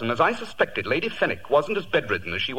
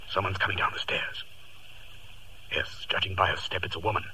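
whispering; 4 semitones lower, 7 dB quieter than usual. Acted. Holmes whispering while hiding.

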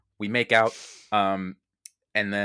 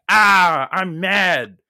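mock angry Timon; 7 semitones higher, 10 dB louder, much slower than usual. Timon voiced in absurd story.

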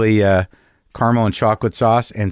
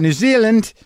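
New Zealand in a botched, barely-there Kiwi accent, pitched up; attempted New Zealand accent; 9 semitones higher than usual. Real, not acted. Host previewing the interview topic, half-attempted New Zealand accent. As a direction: lean oddly on New Zealand as if trying the local accent. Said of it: "my brain's attempt at an accent".

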